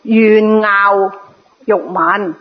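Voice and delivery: 樂 said as "ngaau" in 願樂欲聞; 願樂欲聞 with 樂 read ngaau; corrected reading. Shown as a demonstration. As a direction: pronounce 樂 in 願樂欲聞 like ngaau, not lok. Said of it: "應該係".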